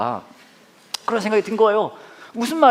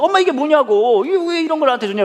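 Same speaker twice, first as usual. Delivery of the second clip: mock complaint; 8 semitones higher, 4 dB louder than usual. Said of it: "엄마한테 막 따졌죠".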